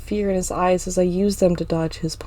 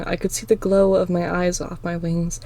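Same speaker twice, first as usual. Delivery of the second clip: ordinary delivery, nothing marked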